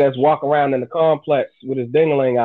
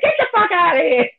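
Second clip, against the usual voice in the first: mother's high shout, pitched way up. Retold or imitated. Mother shouting at a naked stranger, high yell. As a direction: yell him off like a startled mom. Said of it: "my mother was like".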